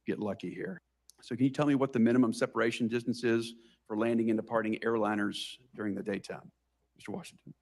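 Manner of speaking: ordinary delivery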